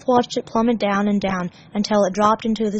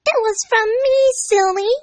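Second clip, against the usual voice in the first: put-on high, loud, giggly girl voice; a playful high girl teasing; high bubbly girl, light laugh in it; laughing Amy; 13 semitones higher, 4 dB louder than usual. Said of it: "she laughed".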